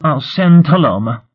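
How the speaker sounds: high, loud parting shot; flouncing off. A narrator's reading. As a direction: toss it loudly over your shoulder. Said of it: "She flounced out".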